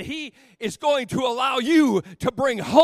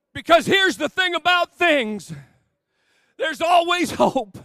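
loud, higher, fervent preaching; full-force preaching; 5 semitones higher, 3 dB louder than usual. Acted. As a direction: build the congregation up with fervour. Preaching climax to congregation.

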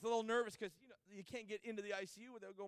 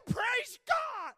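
much higher, loud cry of praise; praise shout; 13 semitones higher, 11 dB louder than usual. Retold or imitated. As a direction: cry it out in joy.

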